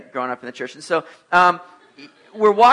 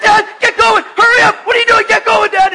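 very high, loud frantic shouting; shrieky, urgent pleading at full volume; acted panic; 10 dB louder than usual. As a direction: imitate a panicking kid yelling at a parent. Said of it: "And he's panicking".

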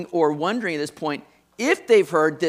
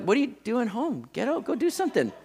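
impatient parent; 6 semitones higher than usual. Retold or imitated. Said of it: "I'm like".

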